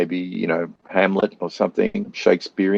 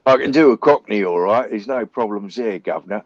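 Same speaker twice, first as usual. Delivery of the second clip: Cockney accent. Shown as a demonstration. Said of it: "I could do Cockney".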